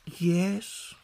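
put-on small, high child's assent; meek. A narrator's reading. John giving in to his sister's plan, meek. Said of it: "agreed John meekly".

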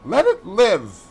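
high, urgent cry to stop. A narrator's reading. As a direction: call out sharply and high, pleading for mercy. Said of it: "he cried out at once".

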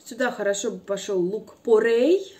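ordinary delivery

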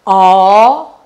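ordinary delivery

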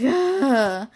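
loud fed-up ugh; disgusted groan. Retold or imitated. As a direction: groan in exasperation. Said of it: "and I'm just like".

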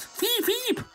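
very high squeaky beeping; alarm beeps. Pretend. Statue alarm sound.

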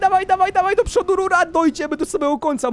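very high, squealing excitement; high-pitched panicky cheering; frantic excitement. Real, not acted. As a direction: urge her on in a high squeal. Fleeing the wave in game.

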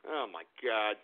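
normal talking voice

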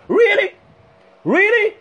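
high-pitched challenging disbelief; incredulous retort. Real, not acted. Rhetorical retort to his critics.

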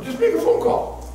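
very high urging; high exhortation. Acted. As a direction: cry it out to them. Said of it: "repeat contrast".

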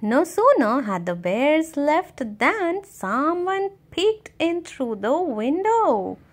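ordinary delivery, nothing marked

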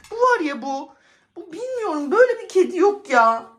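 high, loud exasperated complaint; exasperated rant. Real, not acted. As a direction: vent about this impossible cat. Fuming about the cat. (sinirli yakınma)

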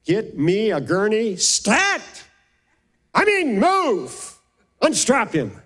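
high-pitched urgent scream of orders; retold scream. Doctor screaming orders at the ER staff, retold high and urgent. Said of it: "she screamed".

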